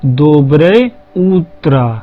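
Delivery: Доброе утро drawn out very slowly; slow model phrase. Shown as a demonstration. Teacher modeling morning greeting slowly for students. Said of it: "सुबह के समय बोल सकते हैं".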